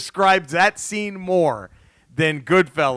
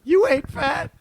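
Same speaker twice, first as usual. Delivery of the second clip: mock [name]; 14 semitones higher than usual. Quoting [name]'s [name].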